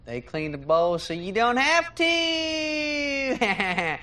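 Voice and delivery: slow, high, shouted commercial slogan. Acted. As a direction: belt it like a TV ad jingle. Mimics cleaning commercial jingle.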